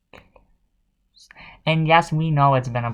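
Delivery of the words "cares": whisper. Real, not acted.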